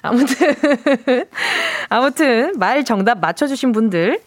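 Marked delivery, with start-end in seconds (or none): 0.6-1.8 amused chuckle; giggling mid-announcement; laugh. Real, not acted. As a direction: let a short laugh slip out. Host laughs before resuming wrap-up.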